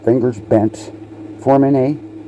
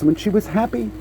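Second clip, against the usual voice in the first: much higher, lilting happy voice; pitched up with joy; bright happy lift; 11 semitones higher, 3 dB quieter, faster than usual. Shown as a demonstration. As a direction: sound delighted. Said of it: "showing happiness".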